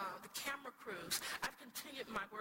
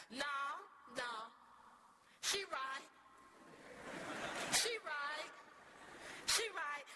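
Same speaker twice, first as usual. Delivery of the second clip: yelled out; 3 semitones higher than usual. Retold or imitated. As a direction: back the speaker up with rising force. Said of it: "yelled out".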